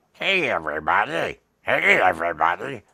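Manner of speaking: a first draft of a puppet's voice; Josh Allen voice try. Shown as a demonstration. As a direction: try out a big booming greeting. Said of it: "what should Josh Allen sound like".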